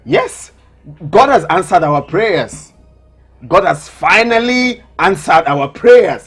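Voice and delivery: elated, slow emphatic announcement, high-pitched; triumphant and breaking into laughter at the end; very excited. Acted. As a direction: proclaim good news, stretching each phrase. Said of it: "You sound so excited".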